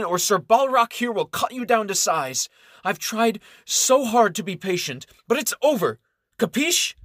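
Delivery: high, loud, heated scolding; angry ultimatum. Acted. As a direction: lay down the law, furious. Florence angrily ends the affair.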